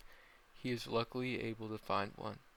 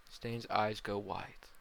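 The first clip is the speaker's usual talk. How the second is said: ordinary delivery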